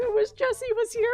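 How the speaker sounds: high, loud mock wail; retold wailing cry. Streamer wails to friends after a character's death, mock distraught. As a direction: cry out in hammed-up longing. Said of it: "I scream for Jesse".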